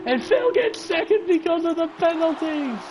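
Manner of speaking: high, excited finish-line yell; thrilled commentary. Real, not acted. Commentator calling a shock second place, thrilled. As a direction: shout the surprise result with pitch way up. Race result suddenly turns at finish.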